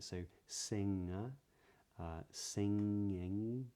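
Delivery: "singer" and "singing" slow, ng with no g after it; "singing" as sing-ing, no hard g; modern british accent. Shown as a demonstration. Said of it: "the G isn't pronounced".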